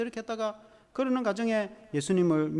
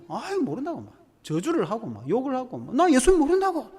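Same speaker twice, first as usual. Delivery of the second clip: higher, louder angry denial; Peter cursing denial; 6 semitones higher, 4 dB louder than usual. Acted. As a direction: swear you don't know him. Peter curses and denies.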